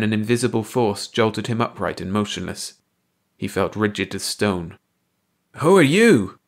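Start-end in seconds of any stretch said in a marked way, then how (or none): 5.6-6.4 loud, strained call with YOU dropping; frightened hoarse shout at the strangers. A narrator's reading. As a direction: call it out, voice scraped raw. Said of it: "Clark called out hoarsely".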